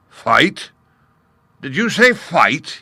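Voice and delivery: loud, high scornful question; incredulous lion. A narrator's reading. Lion scoffing at the gnat's challenge, loud. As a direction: spit the word back in disbelief. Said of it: "he asked".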